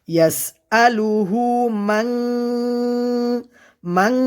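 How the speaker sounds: "من" hummed long through the nose; ikhfa ghunnah. Shown as a demonstration. Teacher showing learners "من" with a long nasal hum. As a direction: hide the n of "من" in a held nasal hum. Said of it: "এখানে ইকফার গুন্না হবে".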